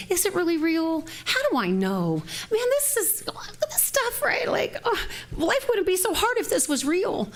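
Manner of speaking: high, exasperated questioning of oneself; confused doubting. Retold or imitated. Speaker voices her own confusion about faith, high and exasperated. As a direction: act out a flustered doubter, voice climbing high.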